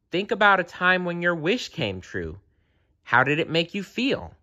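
high, encouraging bear voice; gentle urging. A narrator's reading. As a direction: coax a memory out of him. Bear gently prompts Kevin.

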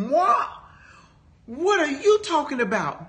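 high-pitched, reenacted disbelief; incredulous retort. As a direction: act out being taken aback, pitch jumping up. Acts out her shocked reply.

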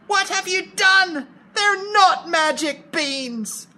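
high, loud, sharp upset mother; sharp mum. Shown as a demonstration. Mum scolds Jack over the beans, sharp and high. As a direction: scold as an emotional, unhappy mum. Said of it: "make it a bit sharper".

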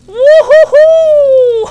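very high, very loud slow woo; hammed-up party whoop; mock lap dance whoop for the skeletons; whooping cheer.

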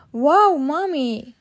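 slow, loud amazed wow; amazed gasp. Acted. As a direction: gasp in wonder. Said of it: "gasped Peppa".

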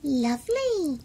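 dainty little mouse voice; pleased mouse. A narrator's reading. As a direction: answer with polite contentment. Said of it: "said the mouse".

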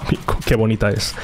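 ordinary delivery

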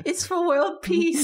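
Anya voice. Acted. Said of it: "Anya from Spy Family".

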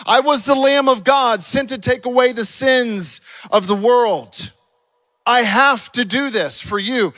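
loud, high preacher's declamation; full-force preaching. Acted. Preacher voicing Jesus to friends, impassioned preaching.

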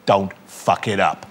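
low, slow, gruff warning; McEnroe deadpan. Retold or imitated. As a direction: mutter it low and slow like a menacing pro. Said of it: "he went".

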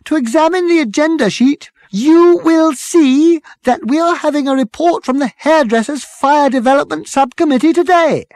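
put-on high, loud, strident girl's voice. A narrator's reading. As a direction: cut in stridently, pitched right up. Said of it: "the girl with the strident voice".

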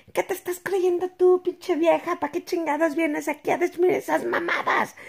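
hammed-up neighbor telling her off; imitated angry neighbor, high pitch; imitating yelling. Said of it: "Esa es mi traducción".

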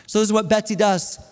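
normal talking voice

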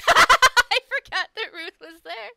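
loud laughing through the words; laughing hard. Real, not acted. Streamer cracking up at own slip, laughing loudly. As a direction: burst out laughing mid-sentence.

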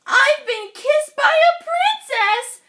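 high, loud, ecstatic; thrilled frog. Acted. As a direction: gush with delight. Frog's thrilled exclamation.

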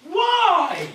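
high, loud protesting cry; shouted why. Real, not acted. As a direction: yell it in disbelief. Protesting a second bag.

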